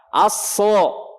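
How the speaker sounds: Aso thick, a rounded toward o; heavy Aso. Shown as a demonstration. Teacher demonstrates sod with fathah. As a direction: fill the mouth on Aso. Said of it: "Sekarang sod fathah".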